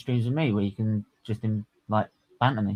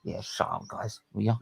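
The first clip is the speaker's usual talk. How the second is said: dismissive hush; 2 semitones lower, 8 dB quieter than usual. Retold or imitated. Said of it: "we're just gonna be like".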